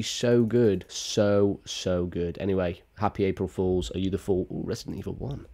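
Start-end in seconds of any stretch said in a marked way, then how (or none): none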